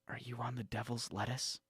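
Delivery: normal talking voice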